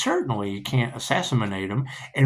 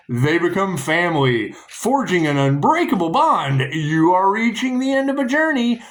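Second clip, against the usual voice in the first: emphatic, higher read-out voice; card reading; 6 semitones higher, 3 dB louder than usual. Acted. Reader proclaims the world card's meaning to the listener, emphatic read-out. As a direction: proclaim it phrase by phrase, pitch lifted.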